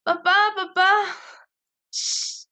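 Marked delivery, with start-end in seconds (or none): 0.0-0.9 high, loud panicked shriek; terrified scream for papa. Acted. Frightened girl shrieks for her father, panicked. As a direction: scream for your father in terror.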